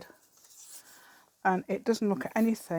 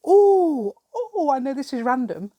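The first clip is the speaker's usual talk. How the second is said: high, loud "oh" of sudden recall; sudden remembering; 7 semitones higher, 11 dB louder than usual. Real, not acted. Vlogger springing a random story on viewers, suddenly excited. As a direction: burst in as a thought strikes you.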